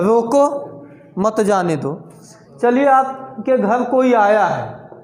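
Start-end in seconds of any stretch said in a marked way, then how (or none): 0.0-2.1 रोको, pause, then lower मत जाने दो; pause after रोको. Shown as a demonstration. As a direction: break between रोको and मत. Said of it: "इस पंक्ति में क्या लिखा है".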